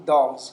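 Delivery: "dolls" with the final s voiced as z. Shown as a demonstration. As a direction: punch "dolls" out loudly with a z ending.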